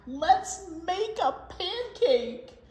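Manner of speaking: very slow, high, loud plea; slow pleading. Acted. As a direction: drag out the begging. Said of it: "shouted Jack".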